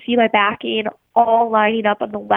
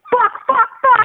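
high, panicked string of curses; panicked cursing; 13 semitones higher, 4 dB louder than usual. Retold or imitated. Fisherman watching his backing collapse, panicked cursing. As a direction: let the curses spill out in alarm. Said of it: "but out loud, I was saying".

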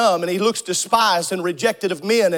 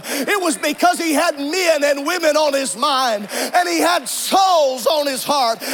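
full-force preaching; 4 semitones higher than usual. Acted. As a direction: preach it out to the whole congregation. Preacher building to full-force climax.

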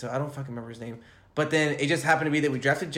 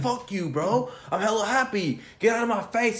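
mock angry fan; 9 semitones higher, 4 dB louder than usual. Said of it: "He's like".

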